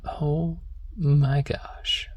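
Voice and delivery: ordinary talk, nothing marked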